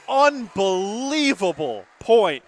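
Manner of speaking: ordinary talk, nothing marked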